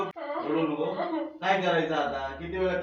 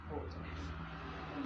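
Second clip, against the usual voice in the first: whispered aside; 10 semitones higher, 15 dB quieter, much slower than usual. Real, not acted. Man quietly telling the helper to take her, whispered. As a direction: whisper it, barely voiced.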